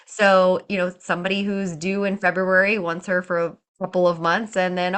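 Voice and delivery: ordinary talk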